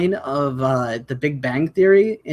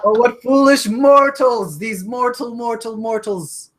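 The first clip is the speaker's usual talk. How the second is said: dark lord; 6 semitones higher, slower than usual. Acted. Wood nymph mocking foolish mortals.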